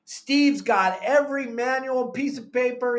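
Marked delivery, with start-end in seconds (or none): none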